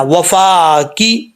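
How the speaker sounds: وفا کی drawn out very slowly; slow careful demo. Shown as a demonstration. Teacher correcting students, very slow demo of وفا کی. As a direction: stretch وفا کی slowly and clearly. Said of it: "اب اس کو وفا کے نہیں پڑھنا".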